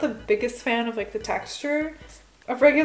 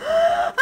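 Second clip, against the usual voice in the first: high gasp turning into a shriek; startled shriek; 5 dB louder than usual. Real, not acted. Crushes the pearls by accident.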